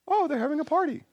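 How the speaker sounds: squeaky high voice of a happy deer; high, soft mock deer voice; deer thinking. Preacher voicing the deer's joyful thought, high and soft. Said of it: "the deer think".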